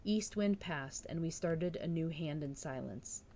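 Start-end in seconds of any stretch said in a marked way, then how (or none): none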